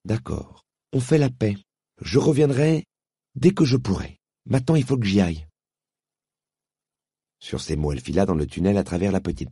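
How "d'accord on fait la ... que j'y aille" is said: put-on higher girl, friendly then hurried; girl promising to return; conciliatory girl. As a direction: make peace and rush off. Polly makes peace.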